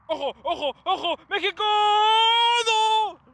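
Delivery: climbing calls ending in a high howl; excited alarm. Real, not acted. Fan following México's attack to a miss, excited alarm. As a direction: build from urgent warning to a loud, stretched cry.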